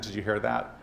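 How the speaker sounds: normal talking voice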